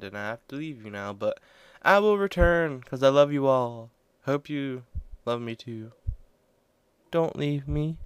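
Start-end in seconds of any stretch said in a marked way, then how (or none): 1.8-8.0 a hint of a clingy ex, louder; mild clingy ex voice; psycho ex-girlfriend. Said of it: "Psycho ex-girlfriend".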